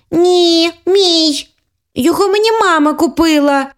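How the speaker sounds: put-on high childish insistence; stubborn little sister claiming the toy; squabbling little girl. A girl insisting mum bought her the bear, high childish voice.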